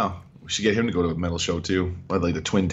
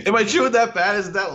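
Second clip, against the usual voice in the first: laughing question; 10 semitones higher, 4 dB louder than usual. Real, not acted. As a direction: laugh while asking.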